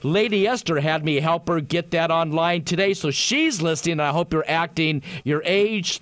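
ordinary talk, nothing marked

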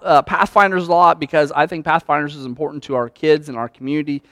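normal talking voice

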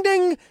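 high sung ding like a cabin chime; imitated ding. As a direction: chime it like the seatbelt sign.

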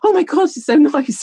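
gushing. Retold or imitated. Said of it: "but I speak".

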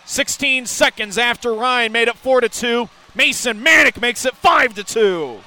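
shouted, high, drawn out; excited goal call. Real, not acted. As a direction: roar it and stretch the words. Fifth goal celebration.

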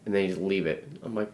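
ordinary talk, nothing marked

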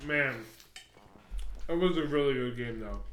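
talking with a mouthful; words round a bite of salad; chewing while talking. Real, not acted. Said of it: "Are you eating?".

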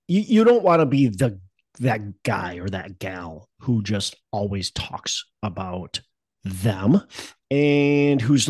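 ordinary talk

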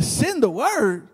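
slow, drawn-out disbelief; incredulous echo. Retold or imitated. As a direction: repeat SEND THE WORD slowly, as if you can't believe it. Friend echoes it in disbelief.

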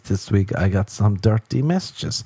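usual speaking voice

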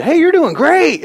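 high, loud, slow cheer; mock runner cheering others. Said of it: "cheering for people".

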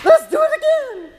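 very high, loud, thrilled cry; thrilled rider. Retold or imitated. Preacher voicing riders after a roller coaster, very high thrilled squeal. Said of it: "we're like".

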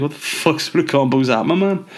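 mock disbelief. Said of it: "It's like".

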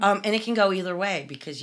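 normal talking voice

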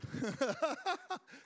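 a real burst of laughter; rueful laugh. Real, not acted. Pastor laughing at the church's money gap. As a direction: laugh it off, high and bright.

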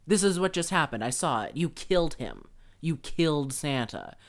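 loud mock accusation from the kid; accusing kid. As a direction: shout it like a kid pointing a finger. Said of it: "immediately was like".

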